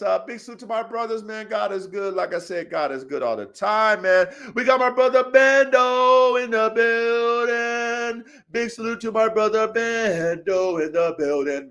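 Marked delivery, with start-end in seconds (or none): 4.5-11.7 slow, stretched-out sing-song announcement; hype shout-out. Real, not acted. Host saluting brother Bando in the building, drawn-out hype call. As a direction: draw out the name like a hype man.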